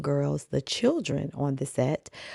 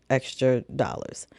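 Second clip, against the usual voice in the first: ordinary talk, nothing marked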